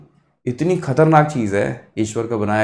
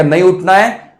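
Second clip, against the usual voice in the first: raised, louder refusal; refusing brain; 5 semitones higher, 5 dB louder, slower than usual. Acted. Brain refusing to get up in the morning, raised stubborn refusal. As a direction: play the brain digging in, pitched up. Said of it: "दिमाग बोलेगा".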